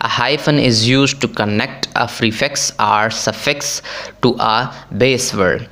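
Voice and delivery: reads the rule out word by word, very slowly; slow rule reading. Shown as a demonstration. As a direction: dictate the textbook rule slowly and evenly. Reads the English rule aloud.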